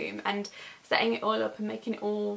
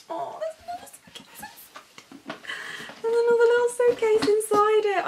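hushed to squealing; 11 semitones higher, 5 dB louder than usual. Real, not acted. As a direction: start breathless, then burst out. Finds nested suitcases.

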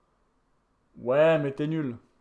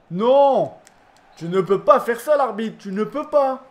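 outraged protest; 5 semitones higher, 5 dB louder than usual. Real, not acted. Protesting the referee's whistle.

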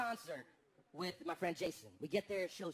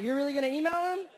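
incredulous; 5 semitones higher, 8 dB louder than usual. Retold or imitated. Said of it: "And I was like".

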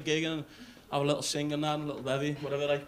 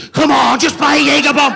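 much higher, louder pleading yell; loud camp shout; 7 semitones higher, 18 dB louder than usual. Retold or imitated. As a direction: shout the plea over him. Seller shouts back.

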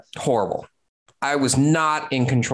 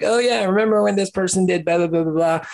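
tipsy gossip; 8 semitones higher than usual. Retold or imitated. Owner joking about an employee at happy hour, tipsy gossipy tease. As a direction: play it like a few drinks in at happy hour. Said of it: "And then you're like".

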